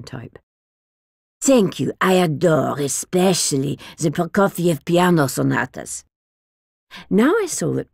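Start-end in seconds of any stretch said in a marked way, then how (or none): 1.4-6.0 put-on grand, foreign-accented old lady, indignant; dotty old lady full of disdain; eccentric old woman. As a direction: scoff at the barbarians who bin good records. Old woman voiced in the story.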